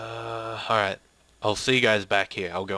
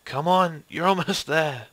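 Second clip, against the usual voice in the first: higher, louder push of encouragement; urgent urging; 5 semitones higher, 4 dB louder than usual. Acted. Character urges someone climbing to safety, urgent raised voice. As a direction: urge them through the last stretch. Acting straining rescue, urging on.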